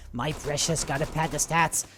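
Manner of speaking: a weak hint of a hissing creature; lightly voiced Gollum, barely changed; Gollum impression. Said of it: "my Gollum impression is, like, really poor".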